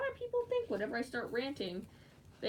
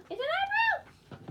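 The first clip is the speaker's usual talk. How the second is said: high gasping squeal; gasp, then squeal it much higher; excited discovery; 6 dB louder than usual. Real, not acted. Realizing there is an eyebrow, real excited squeal.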